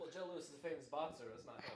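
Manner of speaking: very faint, as if the microphone is off; off-mic. Real, not acted. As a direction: talk from away from the mic. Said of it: "Your mic's off".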